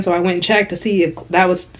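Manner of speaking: normal talking voice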